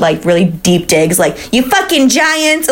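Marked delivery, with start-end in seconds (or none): 1.5-2.7 mock mom yelling in a higher, drawn-out East Coast accent; New York-style accent. Host imagines the killer's mom throwing digs, New York-style accent. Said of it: "I love the accent that you grabbed".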